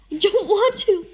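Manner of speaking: high, loud desperate scream; refusing the forced choice at full voice. A narrator's reading. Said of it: "I scream".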